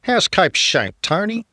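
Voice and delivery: high, drawling cricket commentator voice; lilting commentator calling the game; Richie Benaud impression.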